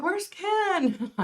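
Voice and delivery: high, sing-song call for a missing friend. Retold or imitated. Streamer retelling how she asked her chat where Ken went, high calling out. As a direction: call out high as if looking for someone. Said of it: "I even said, I'm like".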